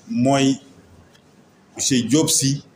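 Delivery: usual speaking voice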